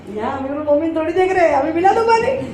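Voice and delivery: lightly acted mother, pitch climbing at the end; storyteller voicing a dismissive mother; mother's voice. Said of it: "ماں کی آواز آئی".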